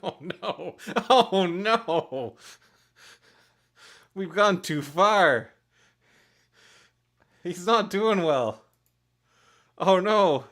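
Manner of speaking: loud, high laughing outburst; helpless, high-pitched loud laughing; laughing dismay. Real, not acted. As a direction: cry out through real laughter at your own mishap. Laughing at own botched drawing.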